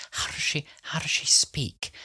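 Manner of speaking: breathy whisper, high; whispered quote. Retold or imitated. Whispering to the doctor about the voice. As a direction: whisper the question like a curious patient. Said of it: "she goes".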